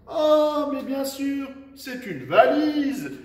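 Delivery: high, loud exclamation, drawn out at the end; feigned discovery. Pretend. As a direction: pretend the answer just dawned on you, exclaiming. Feint de trouver la réponse. (exclamation enjouée de fausse découverte)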